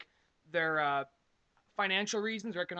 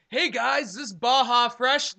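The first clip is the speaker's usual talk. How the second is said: clueless guy voice; 3 semitones higher, 8 dB louder, slower than usual. Retold or imitated.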